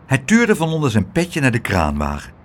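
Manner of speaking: plain reading voice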